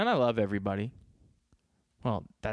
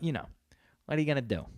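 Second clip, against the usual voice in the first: normal talking voice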